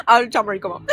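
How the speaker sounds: ordinary talk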